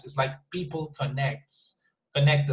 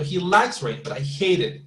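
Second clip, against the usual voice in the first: LIKES and HATE punched, each falling; exaggerated stress on LIKES and HATE; 3 semitones higher than usual. Shown as a demonstration. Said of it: "it's absolutely okay if you exaggerate".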